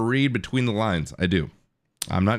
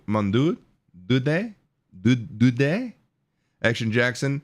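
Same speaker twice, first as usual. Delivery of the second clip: halting, slow attempt at a French accent; unsure foreign pronunciation; much slower than usual. Real, not acted. Streamer fumbling a viewer's foreign message, unsure French accent. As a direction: sound out French names haltingly, unsure of them. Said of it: "I don't know how to say".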